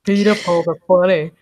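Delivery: put-on imitation of a fellow streamer; Bear. Teasing Bear with an impression of them, imitating voice. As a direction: mimic a friend in a mock voice. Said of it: "that was my Bear impression".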